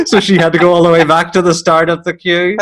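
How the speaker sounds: high, loud words through laughter; laughing while talking. Real, not acted. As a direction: deliver it while cracking up.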